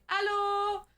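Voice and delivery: high French "Allô"; imitating French greeting. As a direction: mimic French people answering hello. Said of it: "in France literally are like".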